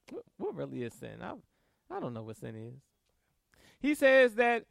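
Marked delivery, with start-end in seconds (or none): 0.1-2.7 low, soft mumble of mock puzzlement; feigned ignorance. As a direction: play dumb, low and under the breath. Mimicking people who deny they sin.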